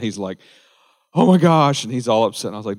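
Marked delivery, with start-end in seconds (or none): 1.1-1.8 imitating a friend freaking out, higher and louder; mock panic, a friend losing his mind; panicked friend. Pastor retelling his friend's panicked phone call, mock panic. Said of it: "he's freaking out. He's losing his mind".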